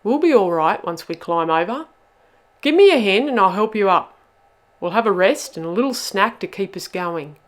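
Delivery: loud, brisk rallying voice; rally the troops loudly; take-charge. A narrator's reading. Bubba takes charge, rallies others.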